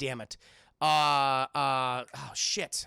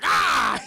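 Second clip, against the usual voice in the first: loud, high imitated shout; short mock cry of alarm; startled yell; 10 semitones higher, 9 dB louder than usual. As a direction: yell out suddenly.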